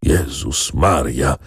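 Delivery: slow, drawn-out groan of dismay; moaning. A narrator's reading. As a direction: groan the exclamation slowly, an old man overwhelmed. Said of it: "jęknął pan Maciej". (jęk, powoli i z przejęciem)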